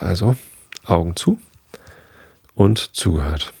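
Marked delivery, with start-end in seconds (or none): none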